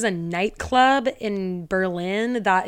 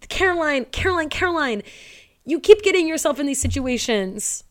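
higher, louder pleading scold; "oh girl, not again" exasperation; exasperated scolding; 7 semitones higher, 5 dB louder than usual. Pretend. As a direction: scold her like a fed-up friend. Exasperated address to Caroline.

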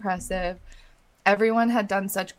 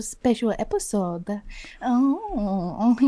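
playful put-on French accent; host the show as a mock Parisian; much slower than usual. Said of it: "[name]'s the best at imitating accents".

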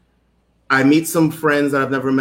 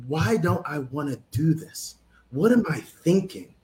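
softly spoken inner doubt; hushed self-questioning; 7 dB quieter than usual. Retold or imitated. Nervous jumper questioning himself on the ledge, hushed. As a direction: question yourself under your voice.

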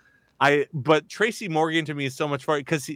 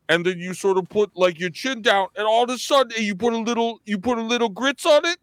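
a hint of Tracy Morgan; 6 semitones higher than usual. Shown as a demonstration. Demoing Tracy Morgan's voice to the host, mildly. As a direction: voice sliding up as chin goes down. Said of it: "use your chest and, like, relax your throat".

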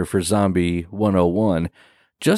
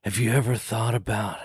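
a hint of a goth girl trying to sound eerie; weird spooky delivery. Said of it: "she does it so weird".

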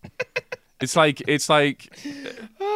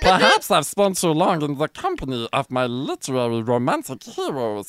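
goofy cartoon alien reading a romance; Jar Jar Binks. Reading erotic novel lines for a friend, Jar Jar impression. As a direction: put on a silly alien voice, breaking into laughs. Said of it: "to read in Jar Jar Binks' voice".

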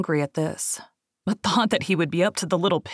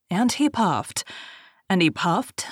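ordinary delivery, nothing marked

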